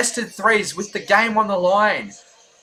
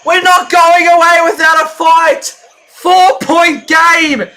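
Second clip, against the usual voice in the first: loud, high cheering as the shot drops; real game-time shouting; excited shout; 6 semitones higher, 5 dB louder than usual. Real, not acted. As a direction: roar it like a fan in the stands. Live game comeback, shouting.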